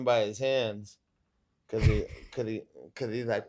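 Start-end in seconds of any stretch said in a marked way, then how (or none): none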